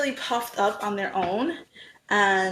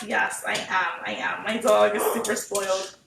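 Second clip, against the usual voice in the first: ordinary talk, nothing marked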